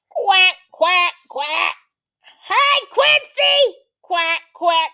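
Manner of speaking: very high, loud quacking voice; shouting duck. Acted. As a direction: holler like an excited, noisy duck. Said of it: "shouts Dottie".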